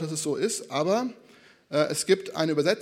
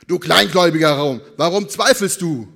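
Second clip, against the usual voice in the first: very loud, hammed-up rebuke; thundering mock reproach; exaggerated scolding; 11 dB louder than usual. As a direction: berate the doubter at full volume. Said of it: "wirklich extrem überspitzt gemalt".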